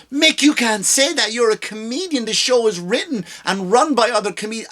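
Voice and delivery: high-pitched imagined critic; mock objector. Imagined objector arguing with him.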